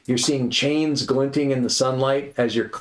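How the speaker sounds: ordinary talk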